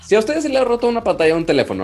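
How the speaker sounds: high, fast pitchman opener; over eager infomercial. Pretend. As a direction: jump in like a TV salesman. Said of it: "lo voy a hacer como infomercial".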